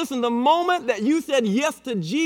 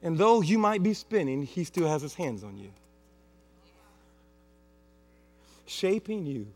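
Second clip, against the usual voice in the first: soft, low reassuring preacher voice; tender reassurance; 8 semitones lower, 6 dB quieter than usual. Acted. Pastor reassuring the congregation, softly tender. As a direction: comfort them gently.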